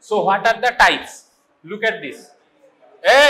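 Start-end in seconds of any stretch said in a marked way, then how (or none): 3.2-3.2 sharp call to the class; calling for attention. Real, not acted. Teacher to a noisy class, sharp call for attention.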